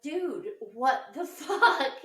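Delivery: slow, laughing exasperation; laughing disbelief. Retold or imitated. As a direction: drag it out while laughing at him. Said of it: "I was like".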